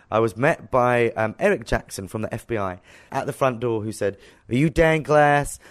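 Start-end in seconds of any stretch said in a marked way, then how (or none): 4.5-5.6 slow, loud imitation American accent. FBI agent at the front door, imitated American accent. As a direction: put on an amateurish American accent. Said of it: "sorry for my American accent".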